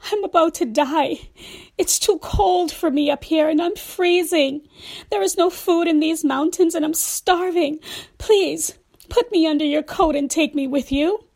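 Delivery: the freezing snake pleads for help; pleading snake. Acted. Snake voiced in read fable.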